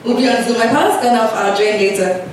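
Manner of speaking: ordinary talk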